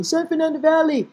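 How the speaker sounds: "San Fernando Valley" in a high, held pitch; playful put-on voice. Repeats place name in put-on voice.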